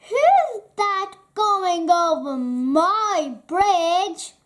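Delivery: loud roaring troll voice; booming troll challenge. Acted. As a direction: bellow it like a monster under the bridge. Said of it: "roared a terrible voice".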